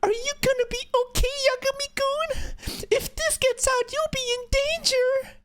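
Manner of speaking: very high, sweet and innocent; sweet high girl voice. Retold or imitated. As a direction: coo it like an innocent schoolgirl. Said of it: "says the most dangerous person".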